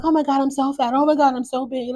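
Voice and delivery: high, mocking whine of a self-pitying wife; mocking whiny wife. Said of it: "she was probably like".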